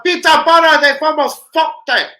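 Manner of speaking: loud high alarm; excited yelling. Real, not acted. Fan shouting at the match, loud and high. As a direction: yell at the near miss. Reacting to near miss.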